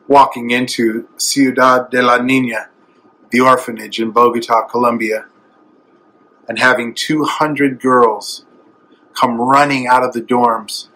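normal talking voice

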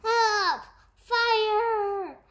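high, loud cry of alarm; fearful bellow. A narrator's reading. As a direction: shout it out like a frightened story character calling for help. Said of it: "he blowed in fear".

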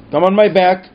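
urgent call. Acted. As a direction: call out to the children to climb on. Father calling children in danger.

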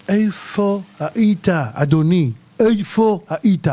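slow, drawn-out accusing question; heavenly judge. Acted. Heavenly court interrogating the dead man, slow and accusing.

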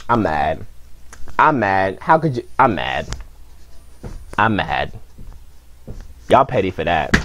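very low, muted grumbling; quietly mad. Real, not acted. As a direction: sink to a low, sulky register after the outburst.

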